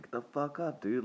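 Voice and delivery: frustrated clerk yelling at the man on the floor; high shout. Retold or imitated. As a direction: snap at the limp guy to get up.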